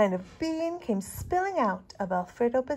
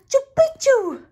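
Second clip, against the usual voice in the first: much higher, loud sneezed shout; sneeze word; 14 dB louder, slower than usual. A narrator's reading. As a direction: burst it out as a sneeze. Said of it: "half sneezed, half spoke".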